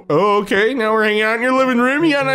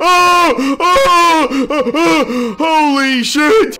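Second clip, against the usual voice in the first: high panicked shrieks, much louder; scared screaming; 8 semitones higher, 4 dB louder, much slower than usual. Real, not acted. Player jolted by a jump scare, screaming in fright.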